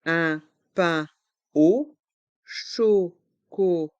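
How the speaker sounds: slow "Un pain au chocolat", a gap after every syllable; learner-paced, split "Un pain au chocolat". Shown as a demonstration. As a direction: separate each sound of "Un pain au chocolat". Teacher repeats syllable breakdown.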